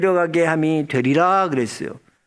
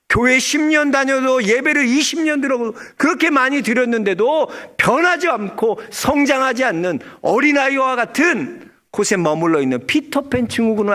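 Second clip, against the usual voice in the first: preaching at full force; 8 semitones higher than usual. Real, not acted. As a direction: press the point on the congregation, building.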